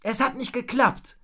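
high-pitched annoyance; vexed, high little outburst; frustrated cursing. A narrator's reading. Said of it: "fluchte Rocco".

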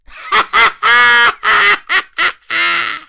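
loud, high mean villain cackle; nasty laugh. Acted. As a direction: cackle meanly, full of spite. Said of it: "Dann lachte er laut".

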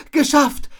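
high, loud blurt after sneezing; loud exclamation. Acted. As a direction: call out the last word. Finishes shout after sneezing. (laut und hoch herausrufen)

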